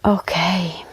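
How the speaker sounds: soft unvoiced whisper; whispering to settle the listener in; whispered okay. Real, not acted. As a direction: hush it as the practice begins. Hushed start of the practice.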